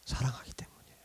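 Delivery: soft unvoiced whisper; whispered answer. Real, not acted. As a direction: breathe the answer like a secret. Answer to why God forgives.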